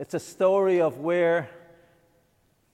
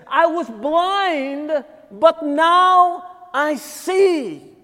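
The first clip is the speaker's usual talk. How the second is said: very slow, drawn-out testimony, higher and louder; blind man's declaration; 9 semitones higher, 6 dB louder, much slower than usual. Retold or imitated. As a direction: savour every word of the healing. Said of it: "what he declared is".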